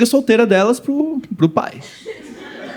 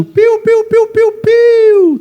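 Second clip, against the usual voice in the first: very high, slow, drawn-out chirps; stand-up comic mimicking a lone bird singing; birdsong imitation; 3 dB louder, much slower than usual. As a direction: chirp like a little songbird. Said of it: "Você vê um pássaro cantando".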